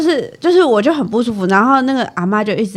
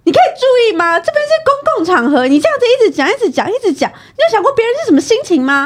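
imitating an outraged mother on the metro; scolding mother; 12 semitones higher than usual.

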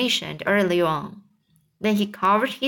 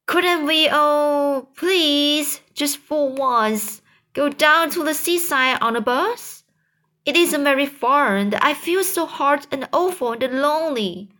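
put-on pleading child; 7 semitones higher than usual. James begging his aunts for the seaside, high and pleading. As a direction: beg them gently, please please.

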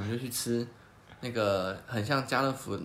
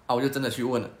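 ordinary talk, nothing marked

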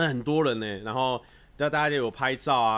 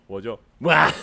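mock dramatic wail; 2 semitones lower, 7 dB louder, slower than usual. Acting the corpse scene again.

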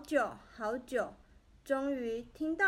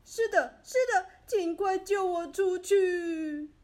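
loud, high, near-tearful pleading shout; 5 semitones higher, 7 dB louder than usual. A narrator's reading. Trapped man shouts up to the child, loud tearful plea. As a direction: beg to be pulled out, voice cracking. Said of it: "没问题先生赶紧大叫".

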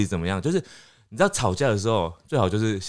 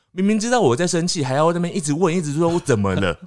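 higher-pitched mock exasperation; acted irritation, pitched up; annoyed complaint; 6 semitones higher than usual. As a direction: complain as the fed-up partner. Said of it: "他也很不爽".

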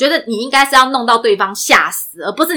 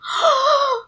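惊呼; 13 semitones higher, 8 dB quieter than usual. Real, not acted. 惊讶于网恋细节.

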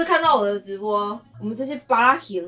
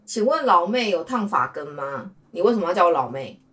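ordinary talk, nothing marked

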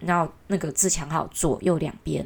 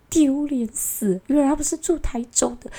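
embarrassed; 8 semitones higher than usual. Retold or imitated. Inner thought, embarrassed for city.

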